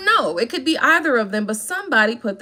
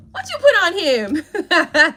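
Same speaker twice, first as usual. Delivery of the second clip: teasing; 6 semitones higher, much slower than usual. Acted. Teasing repeat of question.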